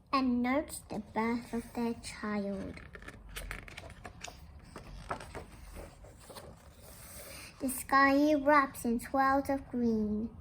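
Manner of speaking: gentle, measured read-aloud voice; storybook reading. Acted. Teacher reading a picture book to the class, gentle storytelling. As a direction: tell it softly to the class like a bedtime book.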